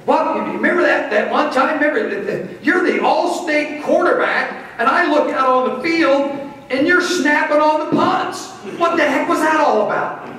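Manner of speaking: high-pitched mom voice, scolding in fun; imitating Mom. Son imitates his mother questioning his punt snapping, high mock-scold. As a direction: squeak it out like a teasing mother. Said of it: "Mom was going".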